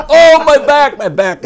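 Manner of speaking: high, loud hammed-up moaning over his back; mock pained wail. Bobby flips in the aisle again, high mock wail. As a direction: ham up a fake back injury, crying out high.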